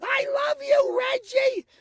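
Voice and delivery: very high, loud, piercing mock squeal; ruinous starlet voice. Host imitating beautiful silent-film women whose careers were ruined, squealing starlet voice. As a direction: squeal it like a silent star with a terrible voice. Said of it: "women would sound like this".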